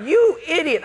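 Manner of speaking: high, loud outrage; indignant retold outburst; exasperated. Retold fury at flooding roommate.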